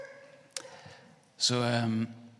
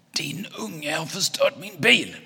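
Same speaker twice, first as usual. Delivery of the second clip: high, loud, slow outraged yell; angry shout; 8 semitones higher, 7 dB louder, slower than usual. Acted. Angry neighbour yells at Anton's dad about car, furious shout. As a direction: drag the accusation out at a shout. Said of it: "skriker den arga farbrorn".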